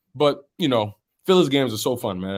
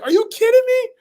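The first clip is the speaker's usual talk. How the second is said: squealing much higher, reliving the moment; retold disbelief. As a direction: relive the comeback in shock. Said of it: "I was like".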